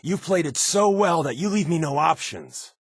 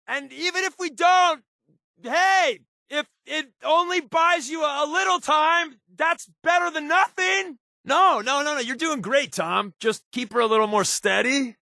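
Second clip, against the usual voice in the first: raised voice over noise. Acted. Talking over noise in flight.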